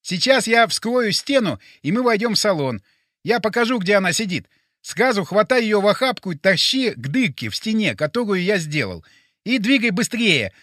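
high, insistent character voice; urgent Boris. Acted. Plays Boris giving orders.

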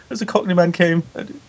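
high, put-on character voice; Vince. Podcaster retells Vince's entrance, high imitation. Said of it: "Vince comes in later on and he's like".

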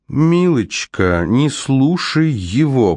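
high, slow scornful drawl; contemptuous. A narrator's reading. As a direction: sneer it down at her slowly. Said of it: "презрительно фыркнула Арахна".

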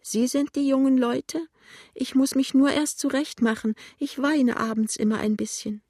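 put-on high-pitched tearful old woman; tearful whine. A narrator's reading. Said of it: "sagt die klagende Stimme". (weinerliche hohe Stimme)

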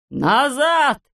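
high, sharp call back; shouted order. A narrator's reading. Klops calling the workers back, shouting. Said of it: "закричал он".

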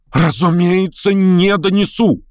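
high, drawn-out indignant cry. A narrator's reading. Lame man answers his interrogator, crying out indignantly. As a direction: cry it out emphatically, pitch high. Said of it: "крикнул вдвое сильнее Хромой".

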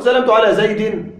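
زيد said "zaydin", ending in -in; kasra with tanween. Shown as a demonstration. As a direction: sound a final -in on زيد. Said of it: "كسرة". (زيد بكسرة منونة في آخرها)

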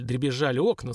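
plain reading voice

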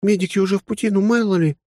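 put-on high, earnest young woman's voice; serious young woman. The lieutenant keeps talking.

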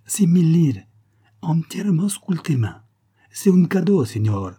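high, loud Italian vendor; Italian accent. A narrator's reading. Shopkeeper naming his price to tourist, Italian accent. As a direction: push the bargain in an Italian accent. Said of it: "L'Italien prit le collier en devanture".

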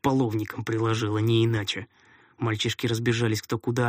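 ordinary delivery, nothing marked

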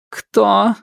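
sharp, high demand; stern widow. Acted. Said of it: "спросила она".

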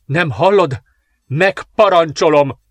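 bellowing. A narrator's reading. Patricia bellowing an order at the frightened midwife, loud slow yell. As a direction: roar the command at her. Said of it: "üvöltötte Patricia".

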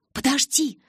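high, urgent interjection; excited interruption. A narrator's reading. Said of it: "замахала руками Джессика".

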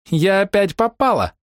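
put-on high, slow, giggly little girl voice; a cheeky girl laughing at her luck; merry laughing girl. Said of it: "с весёлым заливистым смехом".

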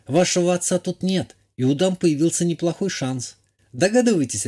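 high-voiced countess giving advice; countess warning.

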